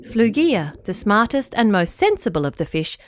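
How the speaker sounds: usual speaking voice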